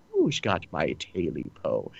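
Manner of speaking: soft, low creature murmur; leprechaun. Acted. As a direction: whisper it low like a lurking creature. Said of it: "He sounds like the leprechaun".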